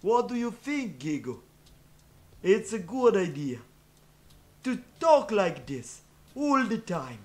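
hammed-up stupid Russian accent; thick mock Russian accent, played dumb; fake Russian accent. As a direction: do a silly, over-the-top Russian meme voice. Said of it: "speaking with a stupid Russian accent".

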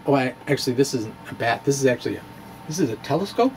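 ordinary delivery

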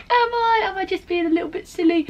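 high, quick mock questioning of herself; mock self-doubt. Vlogger quoting her first reaction to the breakdown, mock self-doubt. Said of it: "At first I was like".

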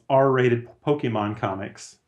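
ordinary talk

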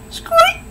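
very high teasing mouse squeak. Retold or imitated. Batman teases unmasked Catwoman, high mouse squeak. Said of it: "and he goes".

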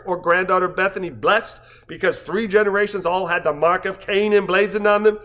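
plain reading voice

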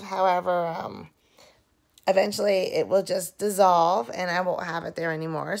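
ordinary delivery

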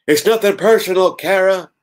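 punning wrestler impression, loud and high; Stone Cold. Lois as a wrestler to Kara, loud Stone Cold. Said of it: "Stone cold voice, get it?".

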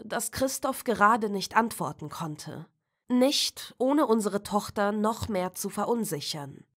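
ordinary delivery

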